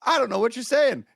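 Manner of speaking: high, baffled reply; confused. Retold or imitated. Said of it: "And I was like".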